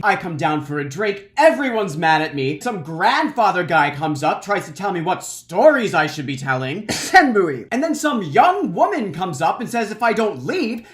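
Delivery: loud, theatrical tirade of a wronged performer; big voice. Retold or imitated. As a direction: project each complaint to the whole village. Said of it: "He's got this big voice".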